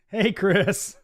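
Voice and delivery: ordinary talk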